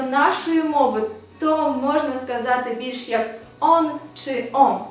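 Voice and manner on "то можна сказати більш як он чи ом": ordinary delivery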